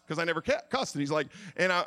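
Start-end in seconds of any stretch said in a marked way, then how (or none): none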